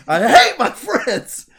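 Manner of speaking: slow, high exasperated holler; Kevin. Retold or imitated. Man quoting Kevin to sum up his friendship, mock-despairing yell. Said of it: "That sentence just sums up".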